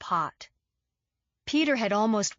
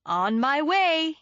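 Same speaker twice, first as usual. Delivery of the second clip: slow, higher West Indian lilt; lilting West Indian accent; 8 semitones higher, 5 dB louder, slower than usual. A narrator's reading. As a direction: drawl it out in a sing-song West Indian lilt. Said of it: "in his lilting accent".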